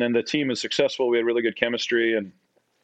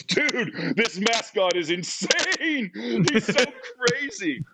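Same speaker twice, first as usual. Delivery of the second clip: much higher, slow, laughing with wonder; awed excitement; 12 semitones higher, much slower than usual. Retold or imitated. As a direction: gush about the crazy mascot. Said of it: "And Geiger goes".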